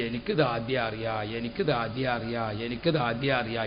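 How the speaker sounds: the preacher mimics the crying wife's repeated wail, softly; imitating a wailing woman. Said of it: "ഇത് പറഞ്ഞങ്ങാണ്ടാ കരയണത്".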